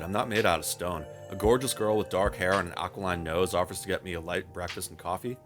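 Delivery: ordinary talk, nothing marked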